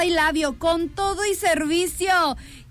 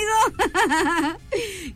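real crying laugh, higher; tearful laugh; 4 semitones higher than usual. Real, not acted. Host cracks up at the twist of the dads' story, tearful laugh. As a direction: laugh till it nearly sobs.

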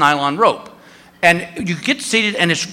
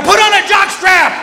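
shouted retort; 13 semitones higher, 4 dB louder than usual. Retold or imitated. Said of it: "I go".

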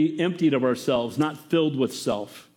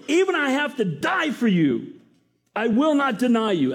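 high, insistent vow of loyalty; a hint of a shout, pitched high; Peter's defiant vow; 7 semitones higher than usual. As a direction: swear it boldly, pitched up. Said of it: "He's shouting".

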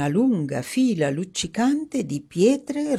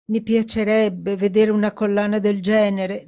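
wistful Dorothy; 6 semitones higher, 5 dB louder than usual. Acted. Dorothy covets the necklace.

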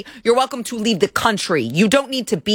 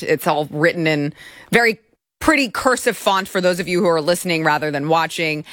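ordinary talk, nothing marked

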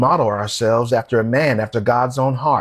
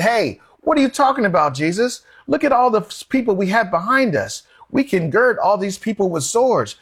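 higher-pitched, pleading objection; urgent protest; 6 semitones higher than usual. Retold or imitated. Peter stopping Jesus to talk him out of dying, protesting. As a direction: cut in and argue him out of it.

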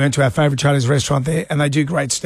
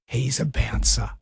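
mock hushed aside; 7 semitones lower, 6 dB quieter, slower than usual. Retold inner thought, realising.

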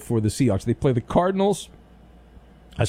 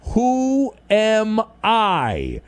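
announcer voice; 6 semitones higher, much slower than usual. Acted. Game show title, drawn out.

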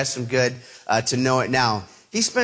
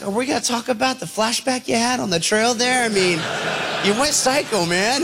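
incredulous; 7 semitones higher than usual. Retold or imitated. Incredulous question to Scott.

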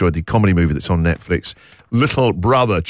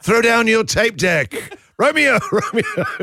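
high, drawn-out theatrical calling, breaking into laughter; hammed-up balcony cry in a high voice; mock Juliet; 12 semitones higher than usual. Radio host spoofing Romeo and Juliet over mixtapes, hammed-up high calling. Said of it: "cassette version of Romeo and Juliet".

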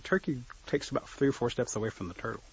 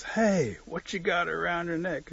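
lightly voiced turkey, pitched up; nosy turkey; 6 semitones higher, slower than usual. Turkey hailing turtle about what he wears, nosy and curious. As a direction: call out a curious question, a bit higher.

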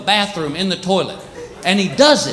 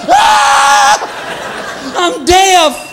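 panicked yell; 12 semitones higher, much slower than usual. Retold or imitated. Visitor panicking at the silent dog, shrieking high. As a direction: shriek as if suddenly deaf.

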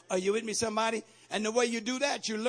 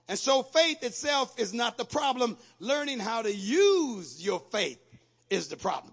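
emphatic preaching; 3 semitones higher, 6 dB louder than usual. Real, not acted. Preaching at full force.

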